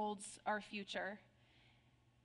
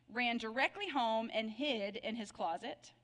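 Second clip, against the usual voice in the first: ordinary delivery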